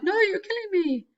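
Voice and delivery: high, hammed-up cry of anguish; mock melodrama, way over the top; overreaction. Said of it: "that kind of overreaction".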